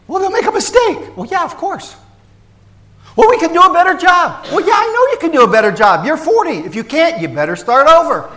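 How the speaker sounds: high, loud mock back-and-forth with worried adults; mocking fussy adults. A pastor mocking fussy scout parents in a high, loud voice.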